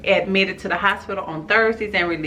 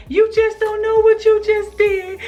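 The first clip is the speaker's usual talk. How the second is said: high wailing sob, far above her usual voice; crying wail; 14 semitones higher, 3 dB louder than usual. Retold or imitated. Woman hit from behind, wailing at the driver through tears. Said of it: "And I'm crying".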